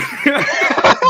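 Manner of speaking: loud, high burst of laughter; big high-pitched laugh. Real, not acted. Laughing after leaving the stream.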